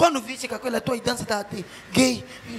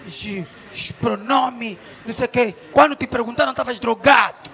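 ordinary talk

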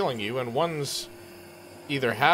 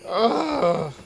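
pained hero voice; 14 semitones higher, 13 dB louder, much slower than usual. Acted. Character voice mid-fall.

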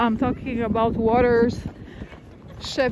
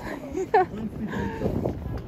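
high giggle; 10 semitones higher, 8 dB quieter than usual. Real, not acted. Laughing after impression.

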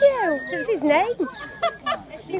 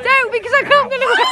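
louder, fast words through laughter; laughing shout; 4 semitones higher, 5 dB louder, faster than usual. Real, not acted. Woman filming shouts through helpless laughter. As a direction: blurt it while cracking up.

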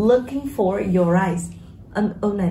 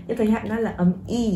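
normal talking voice

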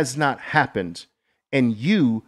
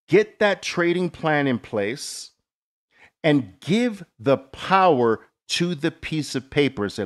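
usual speaking voice

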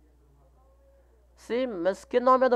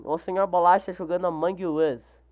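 ordinary delivery

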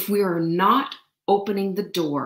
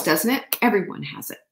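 ordinary talk, nothing marked

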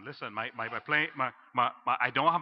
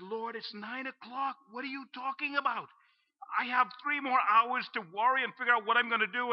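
incredulous protest; 7 semitones higher than usual. Retold or imitated. Young man protesting to God about going to bed, incredulous. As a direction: object as if the idea is absurd.

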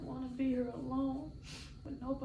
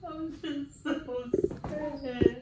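crying; 5 dB louder, much slower than usual. Real, not acted. Crying in hospital bed.

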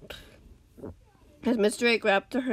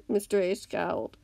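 ordinary delivery

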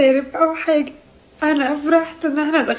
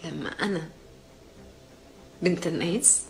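choked quiet crying; 6 semitones lower, 6 dB quieter than usual. Real, not acted. Breaking down, very quiet.

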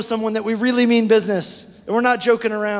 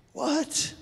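mock surprise; 8 semitones higher, 7 dB quieter than usual. Reacting to dull verse.

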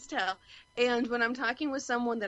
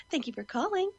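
higher, professional phone greeting; 7 semitones higher, 4 dB quieter than usual. Retold or imitated. She describes answering calls at work, higher professional greeting. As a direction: greet the caller brightly.

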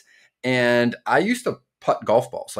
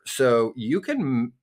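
ordinary delivery

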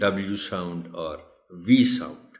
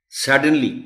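Suddenly with the first syllable punched loud; a model word for pupils, stress on the first syllable; 5 semitones higher, 7 dB louder, much slower than usual. Shown as a demonstration. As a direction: hit the SUD of Suddenly. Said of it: "stress है, खास बात जो एस पर है।".

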